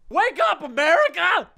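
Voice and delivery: very high-pitched, over-the-top yell; screamed rallying cry. Acted. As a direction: scream it to rouse a nation. Repeats the segment title as battle cry.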